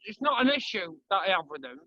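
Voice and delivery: ordinary talk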